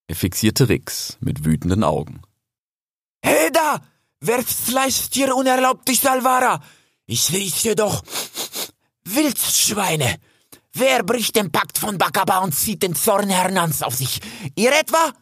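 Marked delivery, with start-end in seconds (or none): 3.2-15.1 high, fierce stag challenging intruders; grim young stag, pitched high; grim challenge. A narrator's reading. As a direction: call out grimly in a high character voice. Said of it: "rief der Hirsch grimmig".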